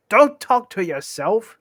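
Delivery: high, loud, slow rebuke; quarrelling troll. Acted. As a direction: tell him off loudly, drawn out. Tom snaps at Bert.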